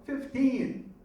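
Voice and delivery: high, loud hopeful shout; yelled out. Retold or imitated. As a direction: shout it out to the whole cellblock. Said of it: "So he yells out".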